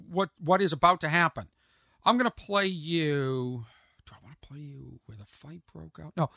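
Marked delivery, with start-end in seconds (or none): 4.0-6.1 whisper, as if thinking aloud; deliberate with yourself in a whisper; very soft, whispered self-questioning; whispered aside. Real, not acted. Aside to himself while choosing clip.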